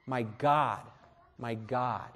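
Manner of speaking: broken cry. Retold or imitated. Jesus crying to God from the cross, quiet and broken. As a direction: let it sink into forsaken grief. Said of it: "He cried out".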